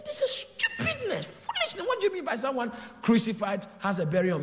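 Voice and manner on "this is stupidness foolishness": mocking. Mocking the intellectuals.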